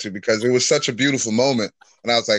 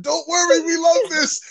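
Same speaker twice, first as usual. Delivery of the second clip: very high-pitched, excited reassurance; mock cheering on. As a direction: squeal encouragement to a crying performer. Acting out cheering her on.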